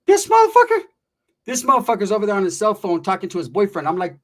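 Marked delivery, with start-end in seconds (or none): 0.1-2.1 very high, loud mock squawk; indignant outburst. Friend scoffs at the supposed veteran, high mock outrage. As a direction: blurt it in a high comic voice.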